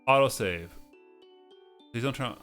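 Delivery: ordinary talk, nothing marked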